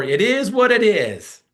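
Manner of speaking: slow, high, loud shrugging excuse; mocking a worker's tired excuse; resigned excuse. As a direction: drag it out like a lazy brush-off. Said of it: "You've heard that excuse".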